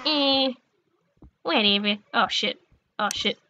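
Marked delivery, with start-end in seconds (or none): 2.1-3.4 whispered oh shit; breathless quiet panic; hushed alarm. Real, not acted.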